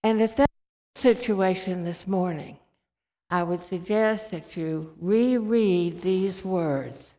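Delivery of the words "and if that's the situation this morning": ordinary talk, nothing marked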